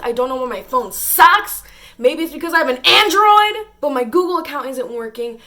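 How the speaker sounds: loud, exasperated venting; frustrated rant. Real, not acted. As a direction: rant it out at the phone. Venting frustration at her phone.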